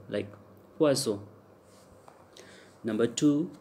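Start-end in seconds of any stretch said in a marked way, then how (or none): 0.8-1.2 "poisson" with a voiceless s; s sound. Shown as a demonstration. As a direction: say the double s in "poisson" as s, never z. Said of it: "then we pronounce as se".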